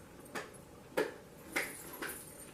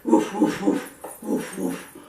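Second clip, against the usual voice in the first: woofs fading to breathy; barking dog; 3 semitones lower, slower than usual. Acted. Dog answers the hen, barking woofs. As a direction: bark then refuse. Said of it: "said the dog".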